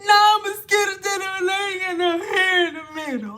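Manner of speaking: high, mock-weepy whine; mock whining. Vlogger mocking women crying over their hair, mock weepy. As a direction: fake a tearful complaint.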